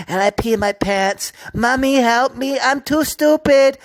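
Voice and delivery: put-on high, whiny plea like a child calling mommy; mock helpless crybaby. Ranter mocking an astronaut as a drowning child, whiny pleading. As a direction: mimic a pathetic weakling begging for help.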